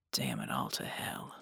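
low, soft mutter; muttered curse. A narrator's reading. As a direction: mutter it under your breath, low. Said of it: "he muttered".

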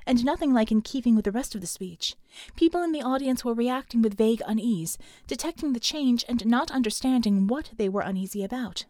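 ordinary delivery, nothing marked

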